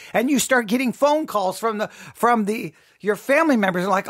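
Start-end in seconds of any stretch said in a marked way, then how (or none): none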